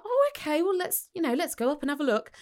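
put-on high, gentle reassurance to a child; reassuring. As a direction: coax a little girl kindly.

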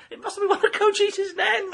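high, loud, gleeful joke delivery; laughing punchline. Real, not acted. Punchline told to friends, high and gleeful. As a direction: crow the punchline with delight.

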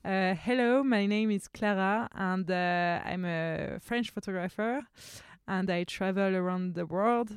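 a French accent in English, slow and careful. Shown as a demonstration. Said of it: "voilà comment je le ferais". (anglais avec accent français, lent et appliqué)

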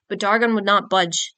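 usual speaking voice

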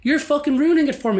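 high, aggrieved retort in a retold row; indignant complaint. Annoying drummer blaming the man in front, indignant. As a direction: complain hotly, pitch way up.

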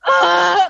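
high, loud joyful scream; excited shriek. Retold or imitated. Songwriter recalling the label head loving the song, loud high shriek. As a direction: let out a thrilled shriek. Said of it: "we were so excited".

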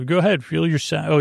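normal talking voice

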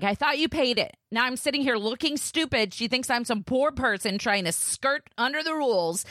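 high-pitched, indignant mock rant; retold snapping. Wife snaps at husband over the unpaid gym, high retold rant. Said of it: "and I snapped".

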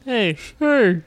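[name]. Acted. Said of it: "It's me, [name]".

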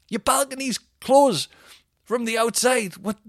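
high, loud mock outrage; indignant complaint. Retold complaint to hotel staff.

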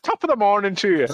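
high-pitched put-on Irish lilt; mock Irish accent. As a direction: do a jokey, hammed-up Irish accent. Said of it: "Can't do an Irish accent".

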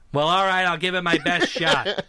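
loud, swaggering movie-star impression; John Wayne. Actor answering a director's accent request, John Wayne impression. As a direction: agree gamely in a big cowboy-star voice. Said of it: "All right, John, we're gonna need you".

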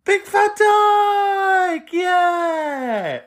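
high, stretched-out whooping call; drawn-out shout. Acted. Host hailing a caller by nickname, drawn-out high shout. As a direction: holler it long and high.

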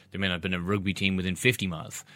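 ordinary talk

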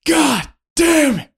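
high, slow exasperated curse; exasperated dad. Retold or imitated. Friend's dad answering apologizing kids, slow high-pitched cursing. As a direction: imitate a father still getting over the scare. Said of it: "He was like".

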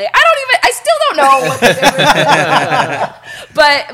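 bursting out laughing; laughing at the mystery. Real, not acted. Laughing at the unclear difference.

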